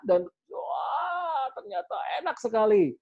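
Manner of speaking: a sudden high shout of delight; excited wah. Shown as a demonstration. Coach showing the thrill of the jump, excited shout. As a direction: cry out with joy. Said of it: "imagining the fun jump".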